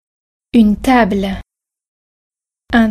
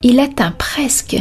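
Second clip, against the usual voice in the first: ordinary delivery, nothing marked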